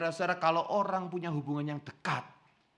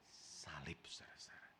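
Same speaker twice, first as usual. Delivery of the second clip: whisper; 12 semitones lower, 21 dB quieter than usual. Real, not acted. Sermon climax on the cross.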